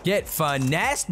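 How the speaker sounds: "finessed" slowly stretched in a gloat; drawn-out taunt. Real, not acted. Gamer taunting a victim, drawn-out gloating. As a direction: draw out "Get finessed" slowly, savoring it.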